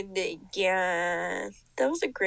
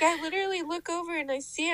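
ordinary talk

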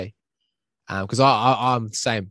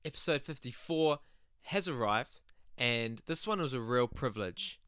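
soft, even intro read; podcast intro; 14 dB quieter than usual. Acted. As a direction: read the episode intro calmly. Pre-recorded podcast intro read.

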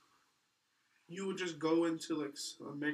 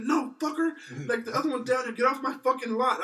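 higher, louder imitated boss yelling; mock angry yell; 9 semitones higher, 10 dB louder than usual. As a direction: bark him off the lot, hammed-up. Boss yells at driver, retold.

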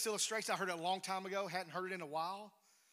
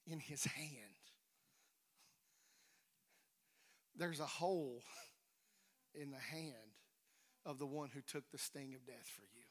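solemn hush; 7 semitones lower, 10 dB quieter than usual. Real, not acted. Solemn climax of illustration.

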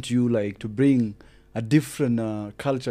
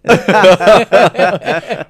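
loud laugh; 10 semitones higher, 16 dB louder than usual. Real, not acted. Laughing at own joke.

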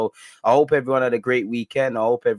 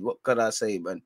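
usual speaking voice

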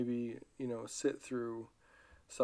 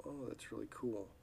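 bland reply; 8 dB quieter than usual. Retold or imitated. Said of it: "we look at it and we go".

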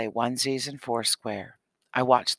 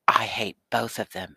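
ordinary delivery

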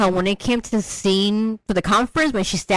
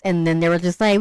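normal talking voice

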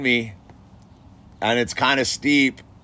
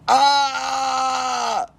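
high imitated wail; 12 semitones higher than usual. Telling friends how the kid screamed, high imitated wail.

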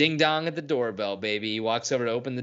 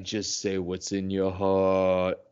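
low, slow and tender; gentle dad voice; 5 semitones lower, much slower than usual. Retold or imitated. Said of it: "he's like".

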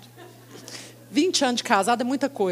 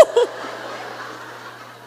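laughing. Real, not acted.

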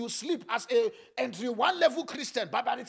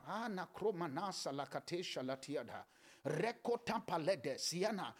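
quiet, unhurried intercession; hushed prayer; 6 semitones lower, 9 dB quieter than usual. Real, not acted. Starts praying in tongues.

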